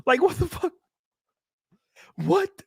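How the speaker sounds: usual speaking voice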